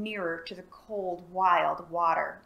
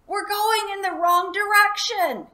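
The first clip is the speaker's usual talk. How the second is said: high, loud call over the thunder; crying out over a storm; 12 semitones higher, 9 dB louder than usual. A narrator's reading. Boy shouting to the dragon over the storm, loud call. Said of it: "cried through the rumbling storm".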